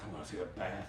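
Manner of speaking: exhausted traveler quietly craving a bath; weary low murmur. Retold or imitated. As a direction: beg softly like a wrecked traveler. Exhausted longing.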